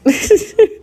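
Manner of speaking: quick high embarrassed laugh; sudden high giggle; laughing at herself. Real, not acted. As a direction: laugh off the slip. Laughs at own wording slip.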